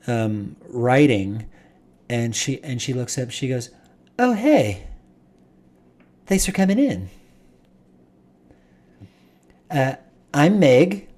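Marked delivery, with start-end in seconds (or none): 4.2-11.1 high bright greeting voice, clearly lifted; young woman greeting. Acted. GM greets the party as a young woman, higher voice. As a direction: play the friendly receptionist, pitched up.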